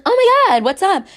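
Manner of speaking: high, bright fake-casual greeting; squealed innocent hello; mock breezy innocence. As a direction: play it breezy, like nothing happened. Girlfriend's innocent act.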